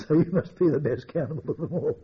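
amused, words shaking with laughter; laughing. Real, not acted. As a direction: crack up at your own dark joke. Laughs at his own cannibal joke.

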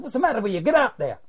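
angry retort. Retold or imitated. Sergeant barking at his mate under fire, loud and impatient. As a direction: shout at him to get moving. Sergeant snapping at comrade in truck.

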